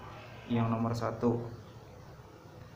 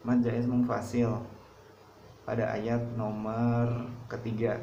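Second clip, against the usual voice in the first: normal talking voice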